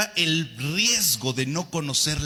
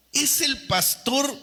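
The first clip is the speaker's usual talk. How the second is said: higher, slow indignant complaint; mock indignant complaint; 9 semitones higher, slower than usual. Congregant grumbling that the pastor means him, mock indignant. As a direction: whine it like an offended listener.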